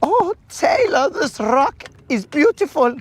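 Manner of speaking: put-on very high, swooning wedding planner accent; Franz. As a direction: gush in a squeaky put-on accent.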